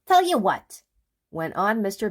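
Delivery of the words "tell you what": much higher, louder burst; eager idea. Acted. Mister Busy proposes a new picnic, excitedly. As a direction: pitch it bright.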